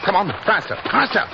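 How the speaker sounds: urgent shout to hurry up the chase. Acted. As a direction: drive them on as they run. Chasing Devers to the lake.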